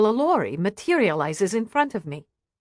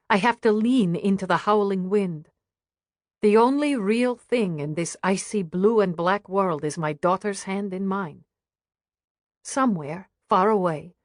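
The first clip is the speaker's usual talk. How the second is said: usual speaking voice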